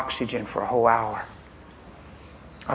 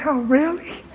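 a lightly voiced, higher surprised "Oh, really?"; wife's shy reply; 12 semitones higher than usual. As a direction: play her coy disbelief. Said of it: "She said".

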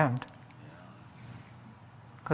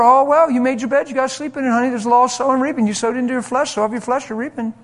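high, loud parody of pious advice; pitched-up imitation of a smug lecture; mock scolding; 10 semitones higher, 5 dB louder than usual. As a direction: rattle off judgmental platitudes dismissively. Parodies people who would scold her.

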